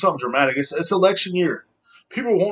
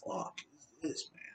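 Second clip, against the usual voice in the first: whispered disbelief; 19 dB quieter than usual. Retold or imitated. Recalling his reaction to the last election, whispered. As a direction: mutter it under your breath in disbelief. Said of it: "because I was like".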